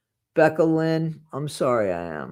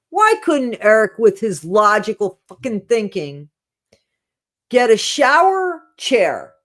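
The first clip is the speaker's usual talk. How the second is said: louder, higher, fed-up ranting about a simple fix; exasperated rant; 4 semitones higher, 7 dB louder than usual. Real, not acted. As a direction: vent at how obvious the solution is. Ranting about the shower situation.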